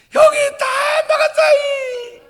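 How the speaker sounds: very high, loud mock bawling; hammed-up child crying, words stretched; crying little brother. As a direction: wail it like a tattling child. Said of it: "이렇게 옵니다".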